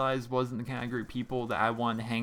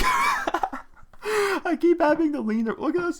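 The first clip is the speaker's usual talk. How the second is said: high, loud, laughing words; laughing hard; 8 dB louder, much slower than usual. Real, not acted. Host showing viewers the awkward lean round the mic, laughing helplessly. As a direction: squeal it through real laughter, drawn out. Cracks up leaning around microphone.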